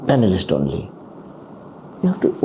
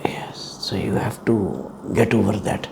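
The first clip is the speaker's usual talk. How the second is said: whisper; 5 semitones lower, 7 dB quieter than usual. Real, not acted. Panel member's aside to candidate.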